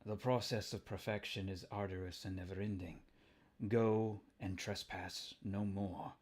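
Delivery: a lightly voiced scheming scientist; menacing villain voice. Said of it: "What kind of voice does he get?".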